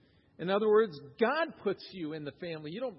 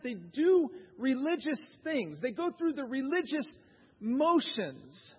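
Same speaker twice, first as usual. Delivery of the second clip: raised, urgent preacher voice; preaching; 7 semitones higher, 4 dB louder than usual. Real, not acted. Pastor urging churchgoers to examine themselves, earnest preaching. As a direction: press the point home to the congregation.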